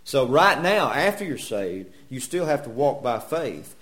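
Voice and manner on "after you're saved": ordinary delivery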